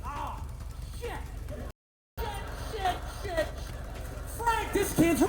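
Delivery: high-pitched, hushed panicking manager; a frantic boss swearing under his breath; panicked manager. Retold or imitated. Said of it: "He's like".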